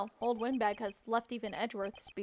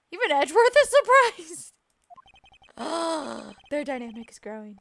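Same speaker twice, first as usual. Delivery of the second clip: ordinary talk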